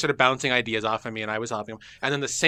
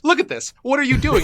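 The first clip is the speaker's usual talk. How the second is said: mock incredulous; 11 semitones higher, 3 dB louder than usual. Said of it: "I was like".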